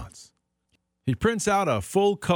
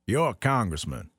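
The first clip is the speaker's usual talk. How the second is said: ordinary talk, nothing marked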